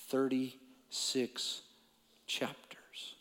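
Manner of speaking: slow, then fading into a whisper. Real, not acted. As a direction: stretch out thirty-six and whisper chapters. Repeated for weight.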